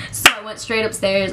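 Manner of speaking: ordinary talk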